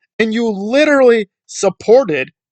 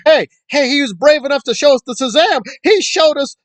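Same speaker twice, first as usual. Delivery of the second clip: mock gushing fan; 8 semitones higher than usual. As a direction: sneer as an adoring fan. Mocking admiring fans.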